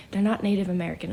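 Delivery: ordinary talk, nothing marked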